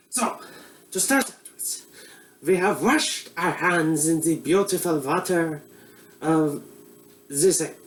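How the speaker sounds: put-on comic Eastern European accent as a bumbling TV cook; broad Russian accent; mock Russian accent. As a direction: play a hammy Russian-accented chef host. Comedic Boris cooking show character.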